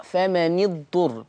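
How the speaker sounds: فمن اضطر read as "famanid-turr"; Hafs kasra on nun. Shown as a demonstration. Teacher showing how Hafs reads, فمن اضطر with "ni". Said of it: "بكسر النون".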